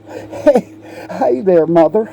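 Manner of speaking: put-on high childish hey there; child calling mother. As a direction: call out sweetly to mother.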